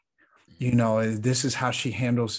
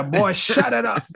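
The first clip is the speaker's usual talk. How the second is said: higher, louder stern yell; mock scolding shout; 10 semitones higher, 8 dB louder than usual. Adult yelling at a boy in the barber chair, loud mock scold. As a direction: snap at the crying kid.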